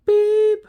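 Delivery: one long electronic beep; imitated beep. Describing the drill alarm, a high mock beep. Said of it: "it was just".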